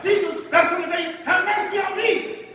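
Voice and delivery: high, loud desperate cry; shouting for mercy. Acted. Woman shouting to Jesus for mercy, loud and high. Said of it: "She starts shouting".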